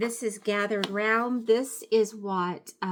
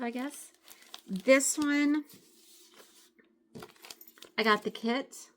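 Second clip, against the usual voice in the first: normal talking voice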